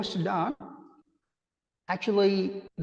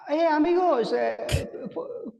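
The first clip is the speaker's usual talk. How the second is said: put-on Spanish accent; 12 semitones higher than usual. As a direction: play up a Spanish accent, laughing. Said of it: "Then, then I said".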